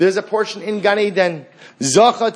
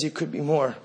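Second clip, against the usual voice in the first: quiet inner voice; 4 semitones lower, 8 dB quieter than usual. Retold or imitated. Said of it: "And it says".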